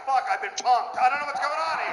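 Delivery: high dismayed outburst; shocked woman. Retold or imitated. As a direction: play a woman who just got pranked. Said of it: "She gets this look on her face like".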